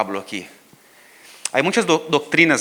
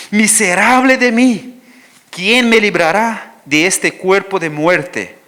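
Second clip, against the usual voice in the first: higher, louder, slow lament; anguished scripture reading; 6 semitones higher, 7 dB louder, slower than usual. Acted. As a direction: cry it out like Paul's lament. Reads Romans aloud. (lamento lento y en alto)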